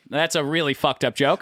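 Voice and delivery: ordinary delivery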